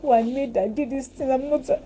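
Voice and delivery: high whiny mock of a regretful twenty-two-year-old; whiny younger self. Mocking the younger self who regrets mistakes, high and whiny. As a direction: whine it like a guilty young girl.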